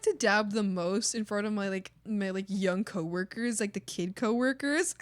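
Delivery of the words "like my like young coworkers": normal talking voice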